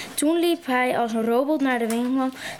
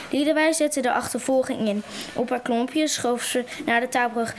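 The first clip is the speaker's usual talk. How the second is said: plain reading voice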